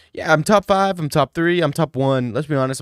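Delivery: loud high bragging; loud boast. Pretend.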